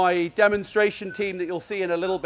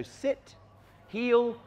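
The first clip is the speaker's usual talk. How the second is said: calm command; 2 semitones higher, 7 dB quieter than usual. Shown as a demonstration. Trainer demonstrates calm commands for sit and heel, softly. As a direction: settle the dog with a quiet word. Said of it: "nicely and calmly".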